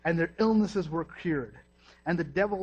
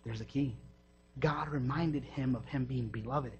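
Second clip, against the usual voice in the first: ordinary talk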